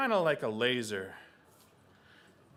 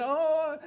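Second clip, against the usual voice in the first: high, loud wail; mock baby cry; 12 semitones higher, 7 dB louder than usual. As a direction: burst out bawling like a baby. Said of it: "Raven starts crying again".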